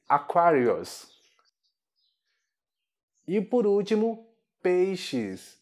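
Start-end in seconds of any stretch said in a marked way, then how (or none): 0.1-1.1 "Aquarius" said slowly in English; English pronunciation. Shown as a demonstration. Teacher shows students Aquário in English, slow English pronunciation. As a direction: model "Aquarius" slowly as a-KWAIR-ee-us. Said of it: "Em inglês".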